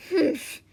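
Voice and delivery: high, loud indignant huff; loud humph. Acted. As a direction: huff it out, offended. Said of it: "muttered Pitter".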